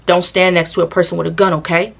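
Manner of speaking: level, low-key telling-off as he really said it; calm imitated warning, no theatrics; matter-of-fact warning. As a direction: state it plainly and firmly, checking at the end. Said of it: "he's literally saying it like this".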